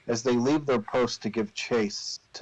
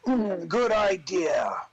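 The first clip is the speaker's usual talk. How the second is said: slow, high goblin chieftain; 8 semitones higher, much slower than usual. Acted. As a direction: drawl it out like a scheming chief. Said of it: "says the chieftain".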